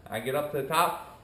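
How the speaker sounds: ordinary delivery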